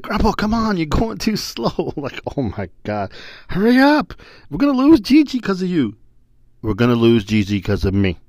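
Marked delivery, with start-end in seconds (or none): 0.0-1.9 put-on high, slow, bossy little kid; grandson's bossy voice. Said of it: "he stops and said". 2.4-5.9 put-on high, loud, impatient kid; grandson's impatient whine.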